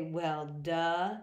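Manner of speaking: put-on a sarcastic teen "Well, duh"; mocking kids' slang. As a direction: sneer it like a bratty kid. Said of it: "Do the children say".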